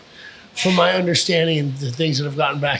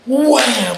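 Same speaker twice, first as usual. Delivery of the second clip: chop sound; 8 semitones higher, 4 dB louder than usual. Retold or imitated. Retold chop impact.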